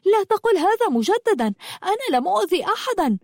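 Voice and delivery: high-pitched, indignant retort; angry denial. Acted. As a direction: hit back at an accusation, pitch way up. Girl angrily denies the accusation. (إنكار غاضب بنبرة عالية)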